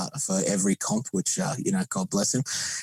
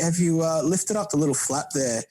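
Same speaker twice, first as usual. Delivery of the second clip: lightly voiced, higher, helpful mate; a hint of a keen barbecue mentor, pitched up; retelling a friend's advice; 8 semitones higher than usual. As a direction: imitate him eagerly pointing it out. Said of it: "he's like".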